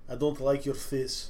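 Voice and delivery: put-on slow, quiet Russian accent; scary Russian roommate. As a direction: do a menacing Russian accent, low-key and slow. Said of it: "this Russian roommate was fucking scary".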